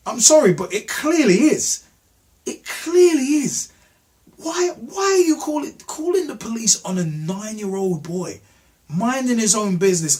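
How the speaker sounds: high-pitched, indignant protest; exasperated outrage. Real, not acted. Challenging the woman who called police, indignant and incredulous. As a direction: pitch leaping with real exasperation.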